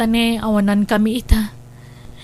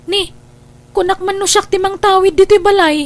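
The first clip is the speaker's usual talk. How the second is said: insistent outburst; 7 semitones higher, 5 dB louder, faster than usual. Acted. Sibling insists on inheriting house.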